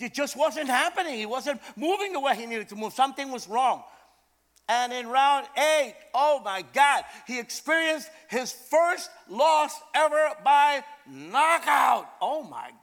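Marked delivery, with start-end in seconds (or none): none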